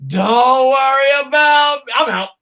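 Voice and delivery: loud, high defiant yell at the boss; mock shouted resignation. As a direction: shout it like quitting on the spot. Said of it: "wrestlers going".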